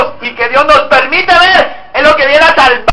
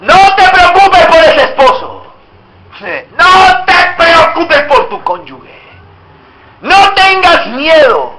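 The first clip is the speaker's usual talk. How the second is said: loud, slow, higher preacher's cry; fiery preaching; 6 semitones higher, 3 dB louder than usual. Acted. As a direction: hammer each promise home, slowly. Preaching God's words forcefully.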